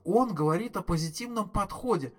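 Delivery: high, aggrieved wail of a ruined day; wailing complaint. A narrator's reading. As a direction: whine it like the worst day of your life. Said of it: "взвыл Артур".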